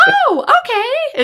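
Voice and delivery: put-on high, slow little-girl voice; little girl's reply. Little girl answering her tutor, high and slow. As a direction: play the child catching on. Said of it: "And she went".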